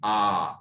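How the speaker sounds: आ called out long and low; a drawn-out आ for the class. Shown as a demonstration. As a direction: draw out आ like a shout. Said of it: "आ में ज्यादा देर लगी".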